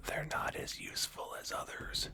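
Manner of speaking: a sly, conspiratorial whisper; whispered aside. Real, not acted. Podcast host whispering a jab at some majors, sly whisper. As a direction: drop to a whisper as if sharing a secret.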